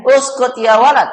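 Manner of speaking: loud, high-pitched order; commanding. Shown as a demonstration. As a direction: order the child sternly. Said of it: "menyuruh atau memerintah".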